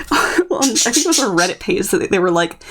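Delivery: usual speaking voice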